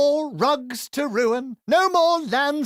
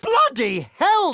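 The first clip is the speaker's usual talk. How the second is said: startled exclamation; 8 semitones higher, slower than usual. Acted. Exclamation at ally's feat.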